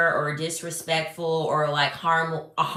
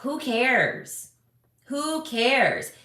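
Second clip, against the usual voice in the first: pitched up, tossed-off who cares; dismissive; 5 semitones higher, much slower than usual. Real, not acted. Brushing off the pet sitter complaint, high dismissive. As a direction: wave it away.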